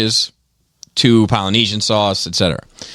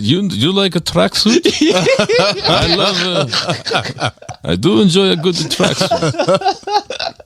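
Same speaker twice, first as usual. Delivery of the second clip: mock Russian guy, higher and slow; Russian accent; 10 semitones higher, slower than usual. Joking about tracksuits in a Russian accent, hammed-up Russian accent. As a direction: ham up a Russian guy's voice. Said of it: "the Russian guy".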